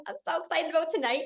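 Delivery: quick, soft, giddy quote; hushed, hurried mock excitement; excited retelling. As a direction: lightly act out eager anticipation. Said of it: "I'm, like, so excited".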